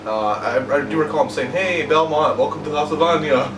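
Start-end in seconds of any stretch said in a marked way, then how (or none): 1.5-3.5 lightly voiced Italian accent; a hint of a mock Italian accent. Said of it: "you're the one doing the Italian accent".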